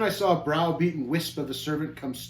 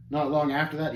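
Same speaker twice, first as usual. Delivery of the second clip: ordinary delivery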